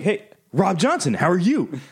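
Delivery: high-pitched mock-chipper hello; playful greeting. Calling a coworker by full name, playful greeting.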